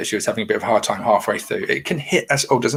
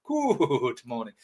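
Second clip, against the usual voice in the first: softer good morning; 6 dB quieter, much slower than usual. Pretend. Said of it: "Everyone's loving the good mornings".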